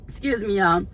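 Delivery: ordinary talk, nothing marked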